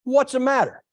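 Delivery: mock gruff demand; waitress Ruth confronting the startled woman. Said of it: "She goes".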